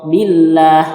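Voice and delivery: BILLAH with a thin L and open "laah"; light lam. Shown as a demonstration. Teacher switching back from heavy, light lam in BILLAH. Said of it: "Kalau dia beringan jadi billah".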